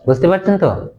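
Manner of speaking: ordinary talk, nothing marked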